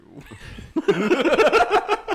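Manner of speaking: real, high, loud burst of laughter. Real, not acted. Laughing at the wizard quip, high real laughter.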